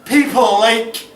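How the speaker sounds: loud, high, drawn-out cry; theatrical high-pitched outburst in verse; rising climax. Acted. Poem builds to accusing climax.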